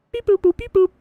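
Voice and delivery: very high, playful robot beeping; robot beeps. Pretend. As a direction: chirp it like a little machine. Imitating beeping machine noises.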